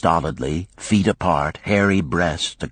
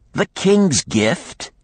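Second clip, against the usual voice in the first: KING'S GIFT drawn out slowly at higher pitch, astonished; surprised echo; 13 semitones higher, slower than usual. Acted. Lender echoes spearmaker about "king's gift", surprised and slow.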